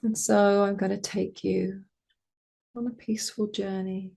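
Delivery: calm meditation voice with long pauses; guided meditation. Acted. Meditation teacher guiding listeners toward joy, calm spacious voice. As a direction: lead them gently inward, phrase by phrase.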